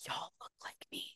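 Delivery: hushed whisper of a private realisation; whispered thought. Retold or imitated. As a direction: breathe it out as an unvoiced whisper. Inner thoughts in audition room.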